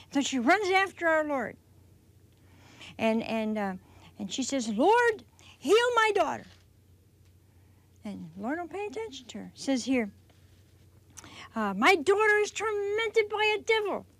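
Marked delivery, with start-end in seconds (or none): 4.7-6.5 high, loud pleading cry to the Lord; raised desperate plea. Acted. Mother crying out to Jesus. 11.7-14.1 high, loud pleading cry to the Lord; raised desperate plea. Acted. Mother crying out to Jesus.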